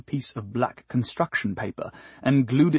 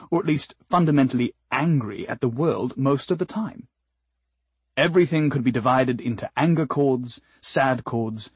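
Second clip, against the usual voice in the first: ordinary delivery, nothing marked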